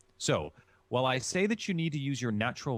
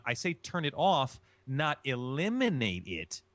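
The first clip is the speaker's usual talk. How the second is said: ordinary talk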